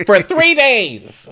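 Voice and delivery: high, slow, drawn-out mock yell; hammed-up high complaint; mock outrage. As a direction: stretch it out in exaggerated exasperation. Mock outrage at practicing a line.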